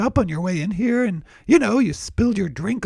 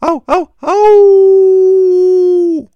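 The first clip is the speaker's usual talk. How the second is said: howling ow like a wolf; loud, high wolf-howl ows; stubbed toe; 10 semitones higher, 13 dB louder, much slower than usual. Acted. Wolf joke punchline, toe stubbed.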